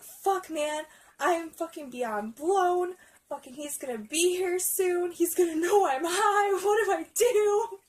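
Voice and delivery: stoned person working up into a panic; mock panic. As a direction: build from worried to loud, high-pitched freak-out. Acts out own stoned panic.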